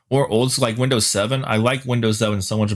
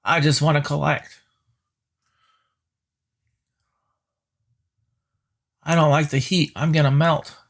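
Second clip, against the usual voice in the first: whiny, slightly higher petulant complaint; whiny tantrum voice; 5 semitones higher than usual. Retold or imitated. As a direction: whine it like a sulking child. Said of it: "They throw tissy fits, like".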